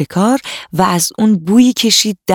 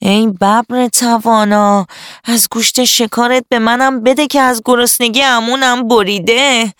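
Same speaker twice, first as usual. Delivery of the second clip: high begging jackal voice; pleading; 5 semitones higher than usual. A narrator's reading. Starving jackal begs the tiger for meat, pleading. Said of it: "شغال با التماس گفت".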